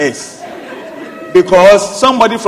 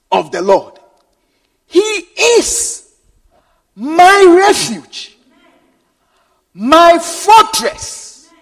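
slow, lifted recitation of the psalm; declaimed scripture; 4 semitones higher, much slower than usual. Acted. Preacher reciting the psalm to the congregation, slow and lifted. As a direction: savour each phrase of the verse. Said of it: "preacher recites psalm slowly".

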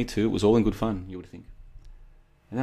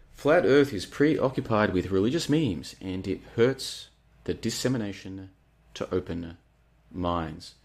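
slow, emphatic, with pauses before "open" and "minds"; deliberate re-reading; slower than usual. Real, not acted. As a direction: punch "Flat Earth", then space out "to open... minds".

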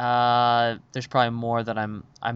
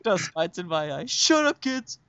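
much higher, squeaky mock snap at the kids; mock defensive dad; 5 semitones higher than usual. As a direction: fake a flustered dad brushing kids off. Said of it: "And I'm like".

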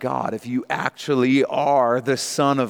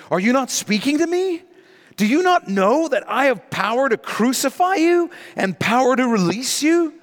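high, raised voice of a rattled governor; Pilate's challenge; 11 semitones higher, 3 dB louder than usual. Acted. Preacher voicing Pilate pressing the silent Jesus, high and raised. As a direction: demand an answer, pitched up and pressing.

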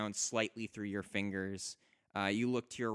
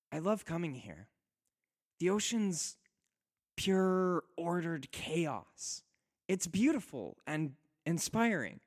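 dreamy wife; 7 semitones higher than usual. Acted. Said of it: "She looks out to the ocean".

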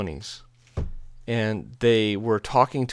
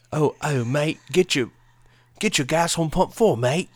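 higher, louder put-on British accent; mock British accent; 6 semitones higher, 5 dB louder than usual. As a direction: lay on the British "mate" accent. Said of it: "that's better, right?".

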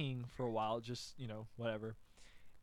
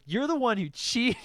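loud, higher, indignant retort; accusing comeback; 7 semitones higher, 7 dB louder than usual. Retold or imitated. Boy answering Carrie's insult, loud accusation. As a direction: throw the blame straight back.

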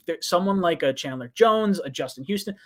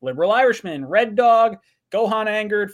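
ordinary talk

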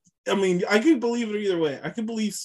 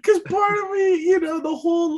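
high, strained laughing wail; crying with laughter; 13 semitones higher than usual. Real, not acted. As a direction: squeal it through helpless laughter. Torn between culture and laughing.

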